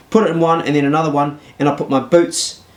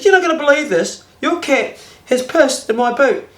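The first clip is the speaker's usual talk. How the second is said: indignant complaint; 11 semitones higher than usual. Retold or imitated. Phoning girlfriend, indignant.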